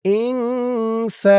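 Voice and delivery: "ইংসা" slow, nasal hum then long সা; ghunnah and elongation. Shown as a demonstration. Teacher joining syllables, ইংসা with held hum and long vowel. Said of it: "সা এক আলিফ টান".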